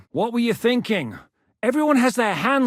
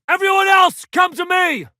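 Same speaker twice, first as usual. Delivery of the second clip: higher and louder, calling the men over; rallying call; 9 semitones higher, 6 dB louder than usual. Acted. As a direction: call them in from across the yard. Commander rallying his men.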